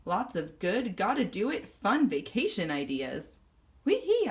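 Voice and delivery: plain reading voice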